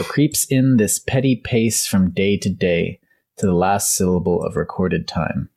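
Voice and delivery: slow, relishing each word of petty pace; slow savoring recitation. Shown as a demonstration. Host rereading the Macbeth line correctly, slow savoring recitation. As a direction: let the line flow off the tongue slowly. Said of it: "That felt good to say".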